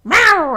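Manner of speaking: sudden loud, high cat yowl; explosive mock cat screech; attacking cat yowl. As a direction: imitate a cat pouncing with a loud "rrow". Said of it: "and just go".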